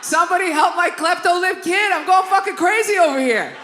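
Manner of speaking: high, loud plea for help; desperate outburst. Acted. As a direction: yell it like you're at the end of your rope.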